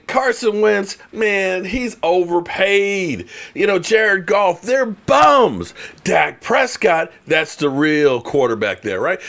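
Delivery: high mock trash talk; mock hot-take rant. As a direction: trash the quarterback like a loudmouth pundit. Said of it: "I can be the one saying".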